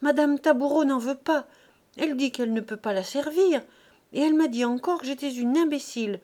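acted distress, words pouring out; tearful protest. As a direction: pour out a tearful grievance. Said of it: "paroles entrecoupées sortit de sa gorge serrée".

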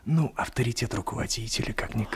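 доверительно шепнуть в сторону; тихо, почти шёпотом, по секрету. Acted. (просит товарища по секрету, шёпотом)

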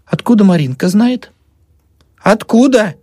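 high, insistent questioning; demanding. A narrator's reading. Old woman pressing the doctor, demanding. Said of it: "требовала старуха".

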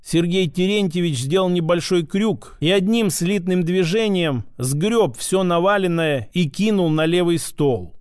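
usual speaking voice

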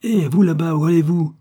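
loud, high hail across the hall; calling out. Acted. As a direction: stop him from a distance. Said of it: "Le concierge du palais de justice l'interpella".

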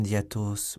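usual speaking voice

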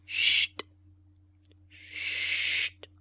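voiceless hiss of hush; urgent whispered hush from all sides; whispered shushing. A narrator's reading. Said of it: "répéta-t-on de toutes parts".

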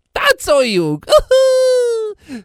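loud high shout then whoop; joyful whoop. Acted. Son shouting for a dance with a high whoop. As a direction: call everyone to dance.

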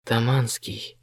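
soft whisper into an ear. A narrator's reading. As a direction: whisper to wake him gently. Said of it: "зашептал я Косте на ухо". (шёпотом на ухо)

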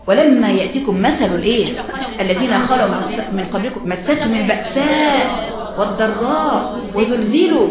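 fervent recitation. Acted. Loud verse recitation.

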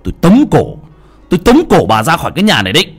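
put-on loud furious yelling at his old mother; furious son threatening to throw his mother out; angry shout.

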